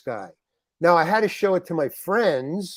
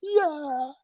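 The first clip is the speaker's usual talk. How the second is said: higher, giddy yay and yeah; inner cheer; 11 semitones higher than usual. Retold or imitated. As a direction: cheer silently to yourself, thrilled. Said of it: "in my head, I was going".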